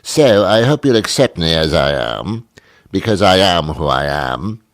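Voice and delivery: mock-refined British accent, theatrically emphatic; put-on British accent. Radio host announcing he is twenty-two percent British, put-on British accent. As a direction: play newly discovered Britishness in a hammed-up British accent.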